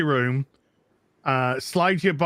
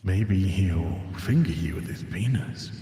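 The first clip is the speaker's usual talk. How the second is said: low trailer voice; 7 semitones lower than usual. Retold or imitated. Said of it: "does anyone say".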